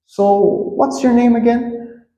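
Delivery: high-pitched, sheepish question; polite re-asking. Shown as a demonstration. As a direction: ask high and politely, as if you forgot. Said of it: "you say it like this".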